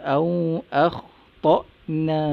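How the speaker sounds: melodic recited verse; lightly chanted recitation; Quran recitation. Shown as a demonstration. Teacher recites verse to class.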